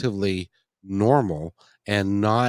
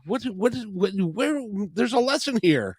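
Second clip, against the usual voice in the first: mock bafflement; 9 semitones higher than usual. Acts out his puzzled reaction.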